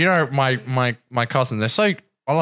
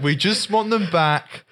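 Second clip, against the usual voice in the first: slow, higher British parody plea; mock British accent; 7 semitones higher, slower than usual. Cousin's family pleads for the guns, mock British accent. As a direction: imitate British parents pleading, drawn out and high. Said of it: "they were literally like that".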